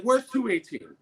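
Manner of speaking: high, exasperated question; annoyed demand. Retold or imitated. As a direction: re-enact an exasperated guest demanding directions. Annoyed guest confronts young clerks.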